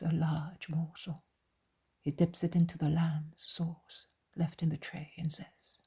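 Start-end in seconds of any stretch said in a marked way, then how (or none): none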